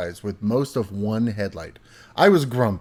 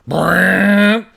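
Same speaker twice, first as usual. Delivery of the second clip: loud imitated engine revving brrr; engine roar; 6 semitones higher, 9 dB louder than usual. Kid imitating dad's car punching the gas, loud engine roar. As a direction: rev it like a car flooring it.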